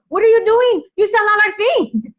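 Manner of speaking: high, loud mock outburst; shocked husband. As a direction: play the husband yelling in disbelief. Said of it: "And my husband say".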